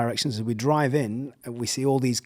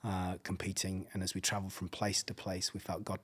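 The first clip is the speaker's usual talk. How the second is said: soft, low, heartfelt telling; hushed retold confession; 4 semitones lower, 10 dB quieter than usual. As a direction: confide it quietly, as if deeply moved. Pastor's tearful confession retold.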